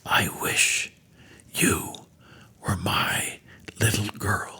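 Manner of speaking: breathy whisper, soft and loving; hushed loving whisper to a child; tender whisper. Retold or imitated. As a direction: whisper it gently like a caring teacher. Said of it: "Her teacher simply whispered".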